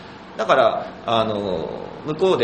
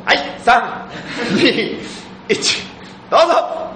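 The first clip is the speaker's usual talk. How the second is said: countdown; 11 semitones higher, much slower than usual. Real, not acted. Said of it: "countdown for instant answer".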